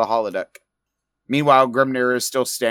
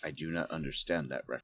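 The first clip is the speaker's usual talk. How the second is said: role; 8 semitones lower, 16 dB quieter, faster than usual. Acted. Deadpan android-style line.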